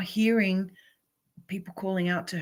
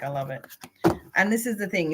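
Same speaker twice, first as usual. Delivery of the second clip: normal talking voice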